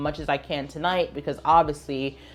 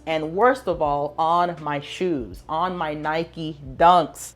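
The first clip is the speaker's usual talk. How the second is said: dramatic slow reveal; slower than usual. Real, not acted. Dramatic reveal of ruined shoes.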